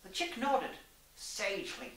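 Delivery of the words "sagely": ordinary delivery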